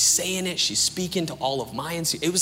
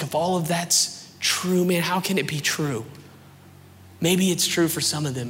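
higher-pitched, insistent questioning; pleading doubt; 5 semitones higher than usual. Retold or imitated. Doubter pressing his friend on faith, pleading. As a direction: press for an answer, pitch held up.

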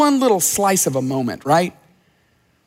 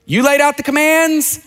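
ordinary talk, nothing marked

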